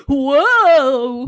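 big loud drawn-out whoa; mock falling yell. Imitating exaggerated flailing in a fall, loud mock yell. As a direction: yell it like a hammed-up tumble. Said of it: "he wasn't like".

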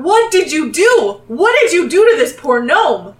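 high, loud, outraged demand; accusing shout. Acted. Tavern guards confront strangers over injured gnome, accusing shout. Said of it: "they start shout".

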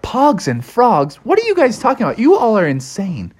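high, incredulous frog voice; disbelieving Froggy. A narrator's reading. Froggy scoffs at his friends' warning, high disbelief.

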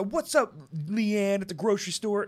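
high, loud, hammed-up hello; mock YouTuber greeting. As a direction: greet a neighbor like a gimmicky YouTuber. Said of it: "no one goes".

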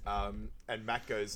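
ordinary talk

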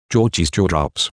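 ordinary delivery

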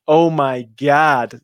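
loud, slow drawn-out oh my God; shocked reaction. Real, not acted. Host stunned by the away record, loud and slow. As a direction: let the disbelief stretch out.

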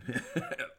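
short nervous chuckle; nervous laugh. Real, not acted. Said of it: "it's a nervous laugh".

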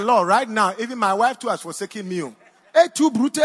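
hammed-up cry to the Lord; half-laughing loud complaint to God; mock anguished plea. Preacher retells crying to God.

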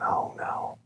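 hushed, barely voiced dread; dismayed whisper. Father reacting to his son's question, dismayed whisper.

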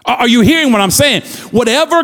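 fervent preacher cadence, pressing each line; preaching. Acted. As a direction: preach it to the congregation. Preaching at full force.

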